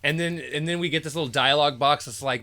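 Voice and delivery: ordinary talk, nothing marked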